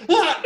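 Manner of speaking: loud, high comic scream; re-enacted scream. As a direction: yelp as if pinned in a speeding car. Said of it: "all I'm doing is screaming".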